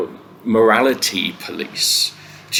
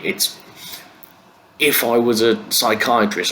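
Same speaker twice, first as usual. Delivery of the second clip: usual speaking voice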